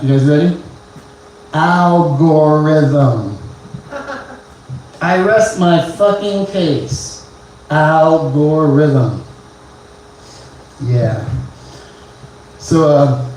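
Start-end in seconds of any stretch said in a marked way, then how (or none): 1.5-3.4 slow drawn-out word. Shown as a demonstration. Slow punchline reveal. 7.7-9.2 slow drawn-out word. Shown as a demonstration. Repeated slow punchline.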